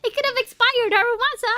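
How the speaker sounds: loud and high, yelling a warning; shouting at the screen. Real, not acted. Streamer yelling a warning at a game character, loud and high. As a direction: call out to him in alarm.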